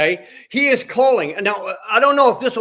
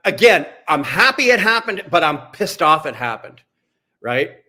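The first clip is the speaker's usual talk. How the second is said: normal talking voice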